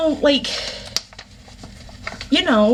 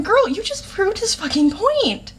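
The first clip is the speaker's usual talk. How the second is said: exasperated sass; 3 semitones higher than usual. Retold or imitated. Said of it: "I'm reading that line going".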